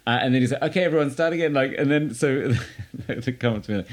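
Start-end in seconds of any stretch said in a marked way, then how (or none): 0.6-1.5 higher-pitched imitation of calling out; rally the room, lightly imitated; director restarting rehearsal. Musical director to whole cast, lightly imitated call.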